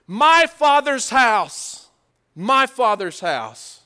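loud, slow and high; slow ringing cry, stretched out; Jesus crying out. Acted. Jesus confronts the money changers in the temple, slow and loud.

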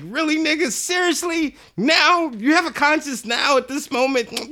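high, loud mock protest; incredulous outrage. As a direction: squawk it in exasperated disbelief. Body part protests in disbelief.

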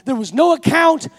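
loud, rising sermon climax; the preacher drives the crowd toward its climax; preaching at full force. Acted. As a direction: preach it to the congregation with fire.

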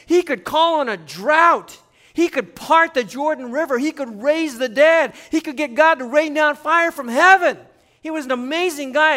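loud, high, fired-up preaching. Acted. Preacher hyping Elijah to the congregation, loud fervent preaching. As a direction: build it like a sermon climax.

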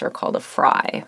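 creaky, crackling low register; vocal fry. Shown as a demonstration. Host demonstrating vocal fry for podcast listeners, creaky fry. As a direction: let the voice break into fry. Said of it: "an example that I demonstrated there for you intentionally".

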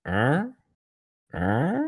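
two soft "Huh?"s, each sliding sharply up; retold coaxing. Michael tempting her with Jamaica tickets, coaxing rising "Huh?". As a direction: nudge for a yes with a rising "Huh?".

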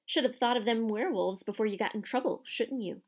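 ordinary delivery, nothing marked

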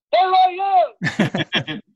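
high, stretched-out excited call; retold yell. Spotting himself on screen, a slow stretched yell. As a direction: call it out slowly to the whole theater. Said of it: "I yelled in the theater".

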